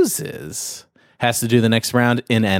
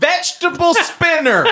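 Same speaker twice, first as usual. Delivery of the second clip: high shout breaking into laughter; laughing shout. Acted. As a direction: yell it while laughing.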